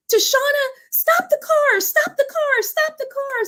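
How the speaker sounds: overjoyed ladies calling out; excited shout. Retold or imitated. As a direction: yell for her to pull over.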